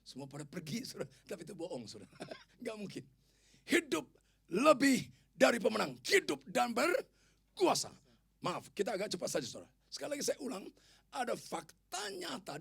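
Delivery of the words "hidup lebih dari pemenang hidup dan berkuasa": loud, high, drawn-out declaration; emphatic proclamation. Real, not acted. Preacher declaring the key verse to congregation, loud proclamation. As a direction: hammer each phrase out slowly at full voice.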